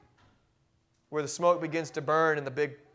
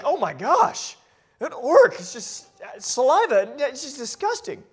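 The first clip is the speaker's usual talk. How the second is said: disgusted at the orc; 8 semitones higher, 6 dB louder than usual. Retold or imitated. Mocking disgust at ugly orcs.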